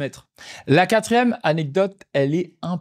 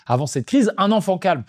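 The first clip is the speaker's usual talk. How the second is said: usual speaking voice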